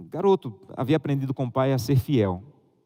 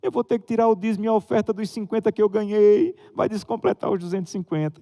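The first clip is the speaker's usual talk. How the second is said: imitating a crying boy; 9 semitones higher, 3 dB louder than usual. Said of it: "Começou a chorar".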